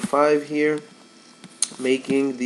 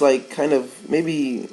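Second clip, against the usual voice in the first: ordinary talk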